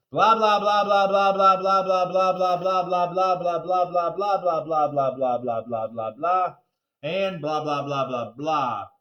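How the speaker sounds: loud, sing-song droning blahs; droning president speech. Retold or imitated. Narrator mimics the president's endless speech, droning. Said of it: "it sounded something like this".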